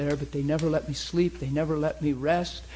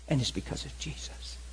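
whisper; 2 semitones lower, 7 dB quieter than usual. Retold or imitated. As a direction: hush it, breath only. Whispered close declaration.

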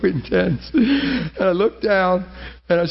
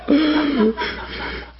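tearful laugh; 10 semitones higher than usual. Real, not acted. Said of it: "I'm about on the borderline right now".

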